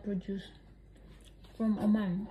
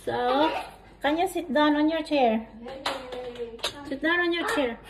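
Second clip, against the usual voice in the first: loud calling; 4 semitones higher, 7 dB louder than usual. Real, not acted. Mom tells child to sit.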